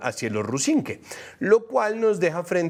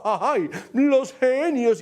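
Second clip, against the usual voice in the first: mock swoon; 8 semitones higher, 3 dB louder, much slower than usual. Mocking the genius claims.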